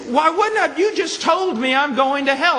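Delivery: high, loud indignant retort; angry man. Retold or imitated. Offended man snapping back at the preacher, high and loud. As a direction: fire back like you've been insulted. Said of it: "He got very mad at me".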